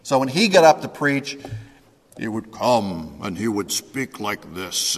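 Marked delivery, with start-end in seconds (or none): 2.2-5.0 imitating a low, resonant preacher; mock deep pulpit voice, a little softer; deep-voiced preaching professor. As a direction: drop your pitch and let it resonate. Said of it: "his voice was probably an octave lower".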